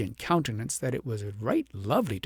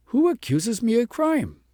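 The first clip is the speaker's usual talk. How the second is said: higher, louder, offended; indignant; 9 semitones higher, 4 dB louder than usual. A narrator's reading. Shepherd demands who accuses him, high and indignant. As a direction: defend your honour. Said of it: "cried the shepherd, indignant".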